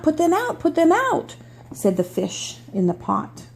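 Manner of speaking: storybook read-aloud voice; reading to children. Acted. Reads picture book aloud.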